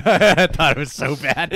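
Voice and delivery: laughing hard while talking. Real, not acted. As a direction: burst out laughing. Laughing at bad movie.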